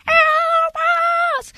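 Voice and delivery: very high, loud, screechy wail. Retold or imitated. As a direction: screech it out urgently, long and piercing. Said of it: "a bit louder and a bit more urgent and a bit more screechy".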